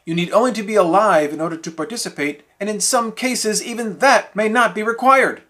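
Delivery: normal talking voice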